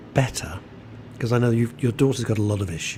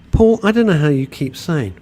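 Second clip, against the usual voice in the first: usual speaking voice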